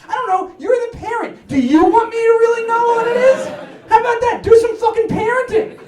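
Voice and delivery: mock outraged retort. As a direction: throw it back at him, fed up. Telling off dad as teen.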